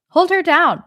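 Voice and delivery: loud high shout. A narrator's reading. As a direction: yell the command sharply. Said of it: "Lin Sijue shouted".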